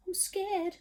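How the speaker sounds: high, whimpering "I'm scared". Acted. Storyteller voicing the cook, high and whimpering. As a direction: whimper it like a timid child. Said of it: "the cook declared".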